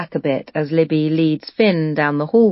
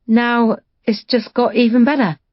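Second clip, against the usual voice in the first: bright, higher, eager hostess voice; replying brightly; 7 semitones higher, 3 dB louder than usual. Acted. Libby welcoming her daughter's father, brightly. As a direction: sound delighted to welcome him. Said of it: "replying brightly".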